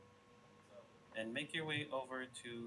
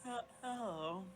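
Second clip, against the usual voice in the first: much higher, drowsy fairy greeting; high sleepy fairy; 8 semitones higher than usual. Acted. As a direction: play the just-woken fairy. GM voicing waking Tinkerbell.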